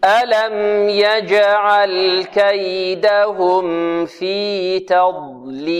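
slow, melodic tajweed chant; Quran recitation. Shown as a demonstration. Teacher leads pupils through the verse, slow Quran recitation. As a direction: recite with long held vowels, very slowly.

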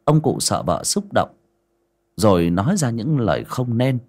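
ordinary delivery

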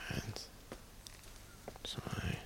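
geflüstert mitzählen; leise zählen. Acted. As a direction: ganz leise Stufe für Stufe. Zählen heimlich die Stufen. (heimlich flüsternd Stufen zählen)

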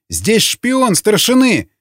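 high, drawn-out alarm cry; full-throated yell. A narrator's reading. Prisoner denouncing his interrogator as a spy, yelling. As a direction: raise the alarm, slow and high. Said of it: "заорал во всё горло Николай".

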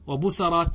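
وبسرات said "wa-busarāt", a after the s; fatha on the sin. Shown as a demonstration. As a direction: open the s of وبسرات to "sa". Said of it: "بالفتح". (وبسرات بفتح السين)

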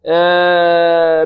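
آه drawn very long as a wrong sound; mock nasal hamza. Shown as a demonstration. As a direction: stretch آه to show the mistake. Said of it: "بدون". (نطق خاطئ ممدود)

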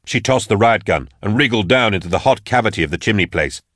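usual speaking voice

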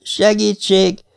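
high, slow, drawn-out plea; eerie high call, slowly drawn; thin little voice. A narrator's reading. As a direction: stretch the word in a small, thin voice. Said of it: "szólalt meg újra az a halk, vékony hang".

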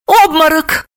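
high, emphatic one-word verdict; high-pitched announcement. A narrator's reading. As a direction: declare the diagnosis in a high voice. Snegurochka states her diagnosis.

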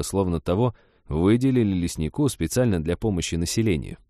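ordinary delivery, nothing marked

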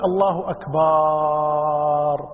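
الله أكبر with the باء of أكبر held long like أكبار; wrong stretched ba. Shown as a demonstration. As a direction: sing it slowly, drawing out أكبر into أكبار. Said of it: "يمد الباء". (مد الباء في أكبر خطأ)

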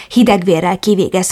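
usual speaking voice

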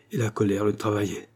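usual speaking voice

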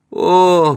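high, loud moan; pained moan. A narrator's reading. Beaten woman lies on the floor, pained moan. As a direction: groan out in pain. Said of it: "простонала Рита".

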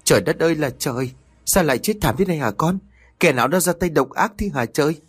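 high, fast acted wail; tearful lament. A narrator's reading. Mother mourns her murdered son, high-pitched wailing. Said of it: "nước mắt nước mũi giàn giụa rồi nói".

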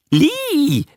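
very high creature yelp; animal bark. A narrator's reading. Beast in front of the man echoing a name, high bark. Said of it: "bellte es vor ihm".

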